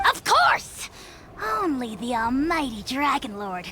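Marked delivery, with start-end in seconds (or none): 0.1-0.9 high proud "Of course!"; gleeful boast. Acted. The dragon proudly agreeing it is evil, high gleeful boast. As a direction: crow it out delighted.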